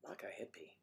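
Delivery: a breathless whispered echo of the phrase; hushed whispered repeat. Shown as a demonstration. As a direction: whisper it back like a sly aside.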